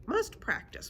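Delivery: high-pitched, earnest creature voice; griffin voice. A narrator's reading. Griffin resolves to practise.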